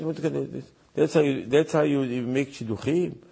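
ordinary delivery

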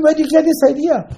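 high, loud incredulous demand; shocked rebuke. Acted. Questioning sons about their shocking idea, indignant.

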